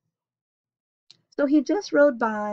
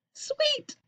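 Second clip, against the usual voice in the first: excited Sweet; 3 dB quieter than usual. Real, not acted. Reader's exclamation.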